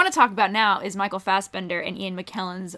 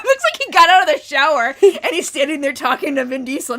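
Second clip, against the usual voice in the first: ordinary talk, nothing marked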